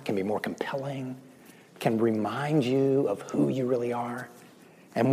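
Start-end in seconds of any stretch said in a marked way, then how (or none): 0.0-1.2 breathy whisper, much softer. Acted. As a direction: let it drop to a whisper. Whispered phrase in sermon.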